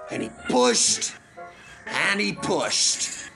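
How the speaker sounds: pushed stretched with a heave; effortful stress. A narrator's reading. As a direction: lean hard on pushed, as if straining. Narrator acts out repeated effort.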